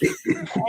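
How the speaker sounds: a short, high burst of laughter. Real, not acted. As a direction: let a bright laugh escape. Laughs at dance party.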